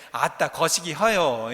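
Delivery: slow Jeolla dialect with a laugh; slow, amused Jeolla dialect. Shown as a demonstration. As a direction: laugh through a lazy Jeolla dialect phrase. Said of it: "전라도 사투리로 뭐라고 할까요?".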